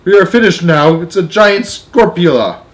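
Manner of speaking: loud, high cry of doom; alarmed yell. A narrator's reading. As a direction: yell out in panic. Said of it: "yelled the hi".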